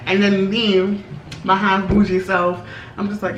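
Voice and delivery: usual speaking voice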